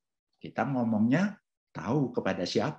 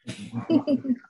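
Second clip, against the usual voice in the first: high burst of laughter; 4 dB louder than usual. Real, not acted. Joking about being misquoted.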